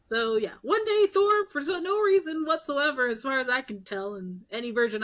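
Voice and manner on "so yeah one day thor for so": normal talking voice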